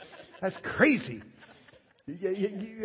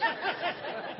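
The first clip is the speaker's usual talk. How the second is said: high giggle; 12 semitones higher, 4 dB quieter than usual. Real, not acted. Laughing at own story.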